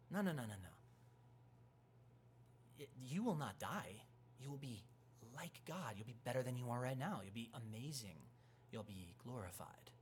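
hushed serpent-like persuasion; tempter. Acted. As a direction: coax them quietly with grand promises. Serpent tempting Eve, acted.

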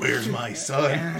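slow, drawn-out menacing line; lightly voiced video game bad guy; slow mock-sinister game quote; mock Fallout villain. Quoting the Fallout villain scene.

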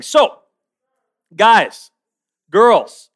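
loud, separate calls to the room; calling out. Real, not acted. Preacher addressing the students at the service, calling out. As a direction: call each group out loudly.

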